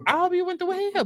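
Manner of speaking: high biting I hope you went through hell; emphatic spite. Real, not acted. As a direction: throw it up high to drive it home. Repeated with big pitch jump.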